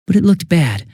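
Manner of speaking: normal talking voice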